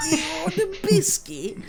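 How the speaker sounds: high squeaky creepy doll voice; possessed. Pretend. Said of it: "Did she say that in a demonic voice?".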